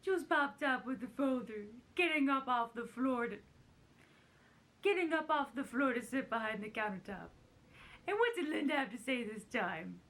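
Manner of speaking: put-on celebrity impression reading a story aloud; hammed-up storybook impression; Carol Channing. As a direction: narrate the page in a comic impression voice. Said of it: "really great in her voice".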